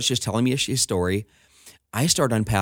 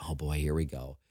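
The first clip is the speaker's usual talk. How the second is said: low, much softer muttered aside; quiet, sinking dread; apprehensive aside; 6 semitones lower, 9 dB quieter than usual. Retold or imitated. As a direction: mutter it to yourself, bracing. Nervous aside as prisoner sits down.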